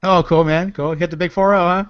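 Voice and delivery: ordinary talk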